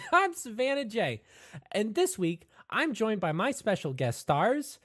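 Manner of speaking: high, loud presenter voice; perky show host Savannah. Acted. Savannah J introduces her special guest stars, perky host. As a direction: announce yourself brightly to the camera.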